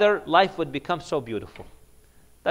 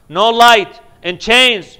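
usual speaking voice

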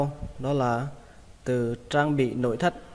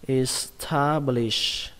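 establish drawn out syllable by syllable; slow breakdown; much slower than usual. Shown as a demonstration. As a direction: say establish very slowly, stretching each syllable. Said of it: "đọc nhanh là". (đọc chậm establish, tách từng âm tiết)

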